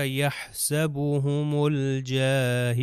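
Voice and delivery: یحسبهم الجاہل recited slowly, lam of الجاہل sounded; Quran recitation. Shown as a demonstration. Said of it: "لام کو میں تلفظ کر رہا ہوں".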